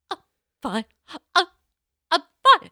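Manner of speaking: high, loud strained fine; forcing herself to sound fine; mock brave face. Leaving the salon with a ruined haircut, strained high voice. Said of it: "I walked out and I was like".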